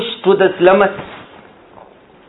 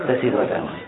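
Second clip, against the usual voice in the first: low, soft and laughing through it; laughing low delight; 9 semitones lower, 10 dB quieter, faster than usual. Acted. Tea drinker smacking lips over the cup, softly laughing. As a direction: savour it with a chuckle.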